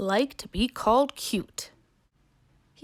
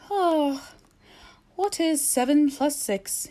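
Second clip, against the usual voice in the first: sleepy owl; 7 semitones higher, slower than usual. Acted. Owl stretched his wings and yawned.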